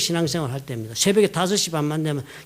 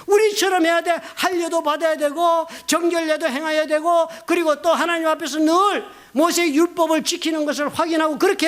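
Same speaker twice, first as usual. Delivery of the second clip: higher, insistent mock voice of the legalists; 8 semitones higher than usual. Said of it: "유대인들은 믿었습니다".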